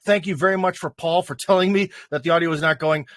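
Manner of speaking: ordinary delivery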